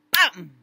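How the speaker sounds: sudden loud imitated bang; bruit fort et soudain. Shown as a demonstration. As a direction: burst out with a startling bang. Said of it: "le bruit est fort et soudain". (un gros boum soudain)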